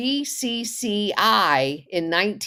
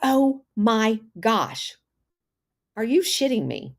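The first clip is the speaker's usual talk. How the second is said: stunned disbelief; 5 semitones higher than usual. Real, not acted. Disbelief at the connections.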